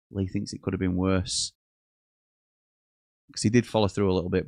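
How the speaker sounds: ordinary talk, nothing marked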